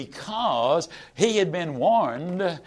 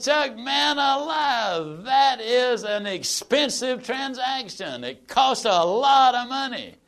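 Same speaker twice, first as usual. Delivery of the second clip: high, slow, stretched-out protest; drawn-out high dismay at a car price; shocked protest; 7 semitones higher, slower than usual. Retold or imitated. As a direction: balk at the price like you've been robbed. Shocked buyer balks at price.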